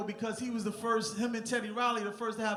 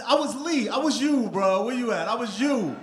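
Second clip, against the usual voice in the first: calling out; 3 semitones higher, 10 dB louder than usual. Real, not acted. Fan to his idol at the event, calling out loudly. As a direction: holler it out to your hero in the room.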